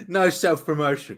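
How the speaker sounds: laughing protest, high and loud; loud, high-pitched amused objection through laughter. Real, not acted. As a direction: crack up while objecting. Laughing at invitation to plug podcast.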